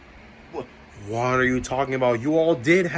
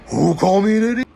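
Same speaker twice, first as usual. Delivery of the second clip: fast, loud, high burst of outrage; offended shout; 6 semitones higher, 8 dB louder, faster than usual. Acted. Villain shouting at whoever called him an idiot. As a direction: storm in yelling, insulted.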